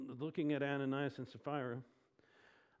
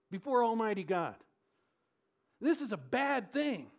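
raised, higher preacher's voice; forceful preaching; 7 semitones higher, 6 dB louder than usual. Real, not acted. Preacher urges the church to take inventory and be real, forceful preaching. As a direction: press it home with force.

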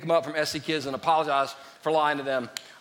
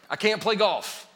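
ordinary talk, nothing marked

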